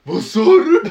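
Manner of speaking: high, loud furious yell; angry shout. Retold or imitated. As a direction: bellow it at the guy. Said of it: "Och han bara". (argt vrål)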